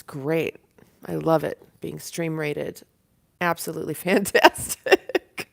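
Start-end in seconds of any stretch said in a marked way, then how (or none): 3.4-5.5 loud, laughing enthusiasm; delighted laughing. Real, not acted. Streamer loves being raided, loud laughing delight.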